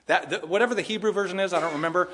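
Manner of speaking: usual speaking voice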